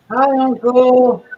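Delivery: high, loud, slow drawn-out call; slow nephew call. Acted. Captured nephew calling slowly to his uncle, high and loud. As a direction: stretch out Uncle like a stranded kid.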